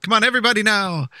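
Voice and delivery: fast, loud, rallying call. Real, not acted. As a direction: get everyone singing. Urging all to sing.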